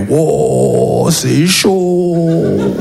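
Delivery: deflated echo. Acted. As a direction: drag the words out, stunned. Own reply echoing friend's cry. (écho traînant, abattu)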